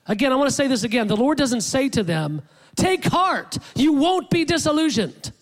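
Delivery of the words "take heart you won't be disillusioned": high, emphatic imagined reassurance; high-pitched mock encouragement; mock pep talk. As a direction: voice a too-easy promise, pitched up. Voicing what the Lord doesn't say.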